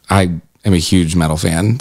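ordinary delivery

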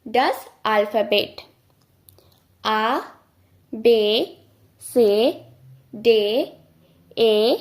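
each German letter said clearly, one by one; German alphabet recital. Shown as a demonstration. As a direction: call out the German letters to drill. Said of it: "চলুন আরেকবার দ্রুত practice করা যাক". (German বর্ণমালা স্পষ্ট করে বলা)

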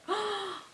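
high, soft gasp of delight; a quick breath in, thrilled; soft excited gasp. Real, not acted. Sees the jumper.

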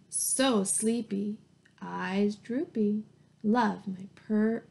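slow, drowsy lion voice; sleepy lion. Acted. Lion dozing on its crash pad, slow and drowsy. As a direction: drag each phrase out like you can barely stay awake.

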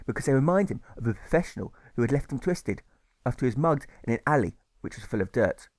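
ordinary delivery

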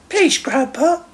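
high-pitched grandson's plea; high, pleading child; young Stephen. A narrator's reading. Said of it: "said Stephen".

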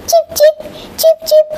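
very high little cheeps; bird chirp. Acted. Animals call to the owl, high chirping. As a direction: chirp high in short bursts. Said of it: "called out with the funny voices".

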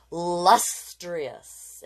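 Lustrous said loud and slow, falling; savoring the word. Shown as a demonstration. Teacher modeling a word for kids to repeat, savoring it. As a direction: relish Lustrous slowly. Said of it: "Everybody say it with me".

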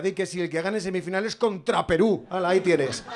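ordinary talk, nothing marked

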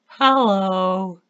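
high, loud squeaky "hello"; imitating younger self. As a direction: mimic your old high-pitched voice. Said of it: "that's me trying to do what I just did".

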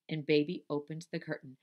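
ordinary delivery

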